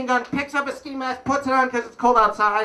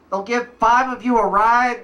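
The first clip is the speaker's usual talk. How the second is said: ordinary talk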